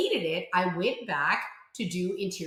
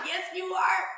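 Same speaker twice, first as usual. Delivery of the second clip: ordinary talk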